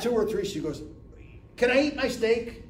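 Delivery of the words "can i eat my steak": high, louder put-upon protest; exasperated wife. Retold or imitated. Wife protesting to husband over her steak, exasperated. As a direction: push back, fed up.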